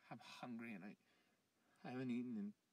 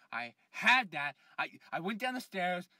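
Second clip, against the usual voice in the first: desperate insistence; 6 semitones higher, 17 dB louder than usual. Acted. Man loudly insisting he saw it all. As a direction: plead that it happened.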